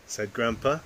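usual speaking voice

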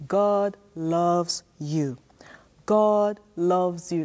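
each word slow and weighty; slow, emphatic God loves you; slow emphatic affirmation. Shown as a demonstration. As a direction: lay it down slowly so the kids can copy. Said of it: "Let me hear you say it to yourself".

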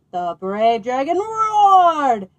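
loud, slow and stretched; slow booming storybook boom; dramatic roar line. Acted. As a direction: let the roar fill the page. Boy's dramatic read-aloud continues.

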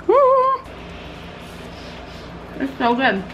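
humming with mouth full; savoring with mouth full. Real, not acted. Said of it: "Sorry, my mouth is full".

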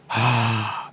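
heavy exasperated sigh; put-upon customer sigh; annoyed sigh. Retold or imitated.

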